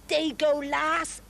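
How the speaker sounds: drawn-out Scouse Liverpool vowels; slow Liverpool accent demo. Shown as a demonstration. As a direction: say it slowly in a broad Liverpool accent.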